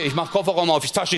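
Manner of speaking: usual speaking voice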